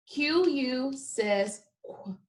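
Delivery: slow, qu clipped into a kw; qu sound. Shown as a demonstration. Teacher modelling the digraph sound slowly. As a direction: say qu as a quick kw. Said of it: "Say it with me".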